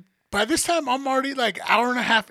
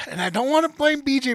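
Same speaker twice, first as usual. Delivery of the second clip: normal talking voice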